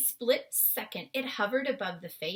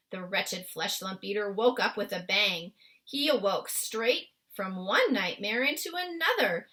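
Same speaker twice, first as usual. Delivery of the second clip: plain reading voice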